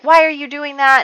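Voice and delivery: high, loud mock scold; mock yelling. Margaret yelling at Abigail over the garden, mock angry. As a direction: snap the question like an angry big sister. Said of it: "like, yelling at her".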